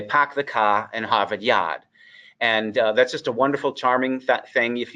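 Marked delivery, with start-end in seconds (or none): 0.0-1.8 non-rhotic Boston accent, R's dropped after vowels. Shown as a demonstration. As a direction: say it like a Boston father, "pahk the cah". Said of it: "the Boston accent is typically non-rhotic".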